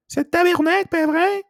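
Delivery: high-pitched mock Quebecois French; Quebecois accent. Showing off some French to English speakers, mock Quebecois accent. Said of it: "I would do the Quebecois accent".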